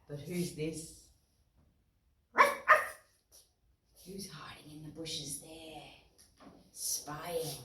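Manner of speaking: soft, hushed, almost whispered; hushed question to children; hushed suspense. Acted. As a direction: lean in and whisper the secret.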